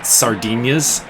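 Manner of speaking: "Sardinias" drawn into extra syllables, sar-DEE-nee-as; audiobook narrator's pronunciation. Retold or imitated. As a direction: say "Sardinias" with an -ias ending, not -eens. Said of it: "I like that pronunciation".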